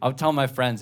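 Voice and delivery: ordinary delivery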